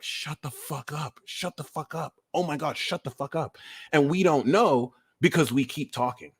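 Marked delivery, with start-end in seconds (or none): 0.0-3.6 much softer fed-up muttering; soft, weary mock irritation; muttered annoyance. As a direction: grumble it under your breath, exasperated. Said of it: "the more they're like".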